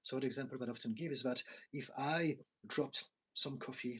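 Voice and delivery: ordinary talk, nothing marked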